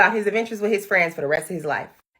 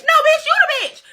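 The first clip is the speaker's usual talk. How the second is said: high, loud sassy retort; sassy clapback; 4 dB louder than usual. Real, not acted. Telling off the movie's heroes, a high sassy clapback. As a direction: snap back at the movie heroes with full sass. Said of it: "I gotta break the fourth wall".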